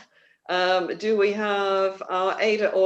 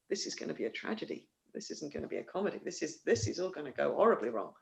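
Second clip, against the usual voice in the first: hushed, fast, mock-ominous; softer, quicker mock-dramatic foreboding; spooky hush; 4 semitones lower, 10 dB quieter, faster than usual. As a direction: drop the voice to warn of doom. Foreshadowing a tragedy, spooky aside.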